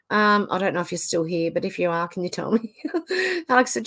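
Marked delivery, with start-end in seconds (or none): none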